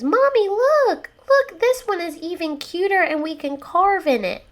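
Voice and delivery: put-on high, loud kid calling Mommy; excited small child. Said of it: "my child walks around".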